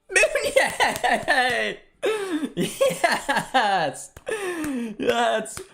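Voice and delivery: loud, high-pitched celebration with a laugh; ecstatic goal shout. Real, not acted. Streamer celebrating Mounier's second assist, ecstatic shouting. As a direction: scream it out in real elation.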